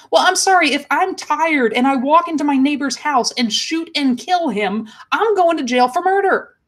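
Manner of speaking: rising outrage at a bad excuse; indignant outrage. Real, not acted. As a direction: passionate indignant protest. Outraged rant about the tired officer.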